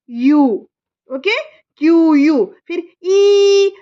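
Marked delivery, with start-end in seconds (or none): 2.7-3.7 ई held long and slow; long drawn ई. Shown as a demonstration. Mother showing her child the long ई sound. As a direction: stretch the ई out slowly. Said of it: "लंबा खींच रहे हैं ना".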